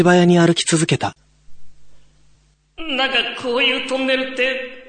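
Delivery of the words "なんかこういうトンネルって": higher, hushed spooky tease; teasing young guy. Acted. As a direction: try to scare the others. (後輩がからかう声)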